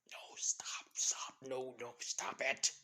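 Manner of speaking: half-whispered frantic warning; silent plea. Acted. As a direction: plead under your breath. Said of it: "like, silently telling him".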